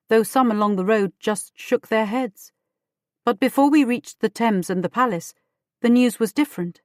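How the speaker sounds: usual speaking voice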